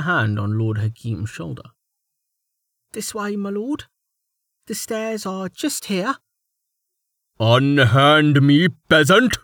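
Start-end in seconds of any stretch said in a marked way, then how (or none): 2.9-6.2 sweetly polite with an edge; false courtesy. Acted. Joanna's mock courtesy. 7.4-9.4 loud, slow pompous noble shout; hammy stage actor. Acted. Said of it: "Lord Hakim shouted".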